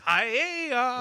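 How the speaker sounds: high mock "Hi-ya" like a martial arts cry; imitating Lance's hi-ya. Host mimics the colleague's final syllables, high mock cry. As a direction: chop out "Hi-ya" up high. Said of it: "That's what Lance says".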